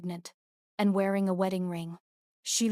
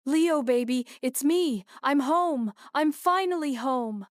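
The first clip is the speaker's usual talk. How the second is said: tearful reunion; 5 semitones higher, 4 dB louder than usual. A narrator's reading. Mother reunites with son.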